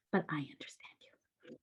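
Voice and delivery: breathy unvoiced whisper, very soft. Whispering to viewers who feel misunderstood, soft and close. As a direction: lean in and whisper it like a secret reassurance.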